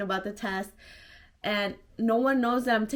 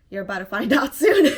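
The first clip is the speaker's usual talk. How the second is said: louder, half-laughed gleeful aside; bursting with a secret she can't keep; giggling that he'll find out soon; laughing inner thought; 11 dB louder than usual. Retold or imitated. Secret thought about telling dad.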